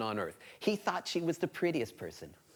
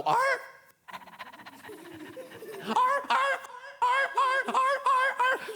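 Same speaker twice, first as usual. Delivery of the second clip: dogs barking; much slower than usual. Acted. Storyteller as dogs spotting a pig, barking. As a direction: bark like a pack of dogs. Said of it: "and the dogs go".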